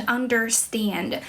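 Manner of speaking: "understand" in American English, R sounded; American accent. Shown as a demonstration. English teacher showing viewers the R sound, American accent. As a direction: say "understand" American-style, with the r pronounced. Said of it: "像美式英语的话".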